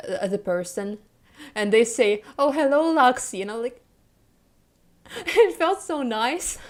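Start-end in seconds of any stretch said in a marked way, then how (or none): 2.4-3.4 higher, louder quoted hello; light, lifted greeting to a viewer; cheery retold greeting. Streamer greeting a new viewer in chat, cheery. 5.1-6.6 high, slow giddy gush; giddy delight. Real, not acted. Viewer thrilled at being noticed by a streamer, giddy.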